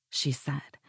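ordinary delivery

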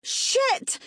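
high-pitched explosive curse; sudden shouted expletive; roaring. A narrator's reading. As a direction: roar it in alarm and fury. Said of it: "Lexi roared".

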